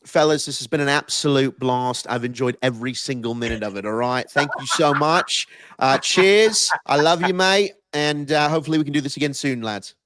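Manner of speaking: put-on a light British accent, high on the thanks; English accent. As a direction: say goodbye like an English bloke, cheers mate. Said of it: "Do you wanna do an English accent".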